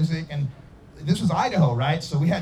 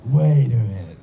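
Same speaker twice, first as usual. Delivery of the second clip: low, loud, suspicious halt; dawning realization; 7 semitones lower, 5 dB louder than usual. Retold or imitated. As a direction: drop the voice as it hits you. Sudden realization retold.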